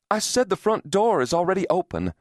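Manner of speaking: high, insistent repeat; insistent repetition. A narrator's reading. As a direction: make the distracted listener take it in. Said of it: "she repeated".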